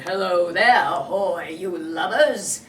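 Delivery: low, loud pirate captain bellow; boy faking Hook's deep voice; Captain Hook impression. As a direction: boom it like Hook. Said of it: "make his voice sound just like Captain Hook".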